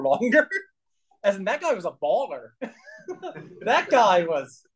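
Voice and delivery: high, giggly retelling, near laughter; can barely hold back the laugh; laughing through the story. Real, not acted. Recalling the pizza guy in a meeting, laughing high.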